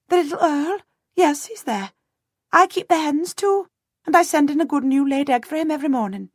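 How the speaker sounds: put-on high, quick voice of a flustered peasant girl; a startled servant girl piping up to a lord.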